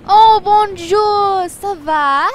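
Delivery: high, loud, slow hammed-up French accent greeting; over-the-top French accent bonjour; mock French greeting. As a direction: gush it like a tourist playing at being Parisian in a French accent. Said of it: "Yeah, I take French".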